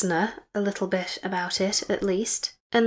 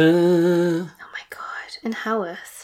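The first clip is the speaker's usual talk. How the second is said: shocked whisper; 5 dB louder, slower than usual. Real, not acted. Shocked at the Haworth link.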